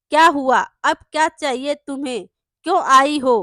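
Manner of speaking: fast, loud and annoyed; irritated loud snap. A narrator's reading. Annoyed landlord answers the door.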